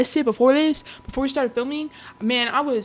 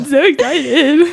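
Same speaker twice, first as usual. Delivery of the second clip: excited; 5 semitones higher, 8 dB louder, slower than usual. Real, not acted. Gushing about the movie.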